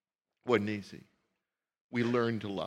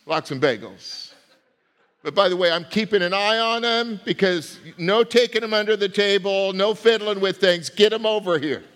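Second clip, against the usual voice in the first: mother calling across the supper table, higher; mock scolding mother, a bit louder; nagging mother; 5 semitones higher, 3 dB louder than usual. Mother at supper scolding her son Jacob. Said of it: "his mother saying".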